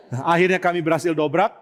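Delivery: ordinary delivery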